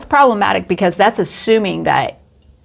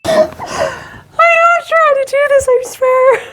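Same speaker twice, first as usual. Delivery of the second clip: squeaky-high words through laughter; laughing to tears; much slower than usual. Real, not acted. Host swearing she is trying, helpless with laughter. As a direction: struggle to speak while crying with laughter. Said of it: "There's tears in our eyes".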